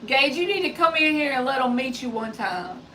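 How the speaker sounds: usual speaking voice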